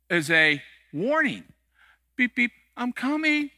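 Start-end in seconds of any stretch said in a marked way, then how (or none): 2.1-3.5 a lightly voiced cheerful car horn, slow and high; friendly horn voice. Pastor voicing a car horn's polite warning, light playful tone. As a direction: play the horn as a gentle warning.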